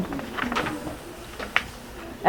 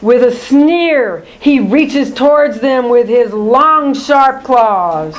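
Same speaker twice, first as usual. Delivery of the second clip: ordinary delivery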